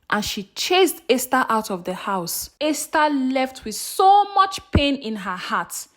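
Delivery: usual speaking voice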